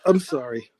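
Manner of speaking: normal talking voice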